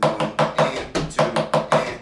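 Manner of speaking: teacher counting each beat slowly and clearly; slow counting demo. Shown as a demonstration. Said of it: "you can do the opposite".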